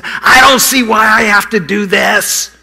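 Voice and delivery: whiny defiant shout, high and loud; bad attitude. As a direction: protest like a sulky child. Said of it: "I had a bad attitude".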